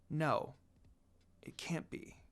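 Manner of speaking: soft denial trailing into a whisper; barely voiced disbelief; whispered no, it can't be; stunned whisper. Hears a familiar voice.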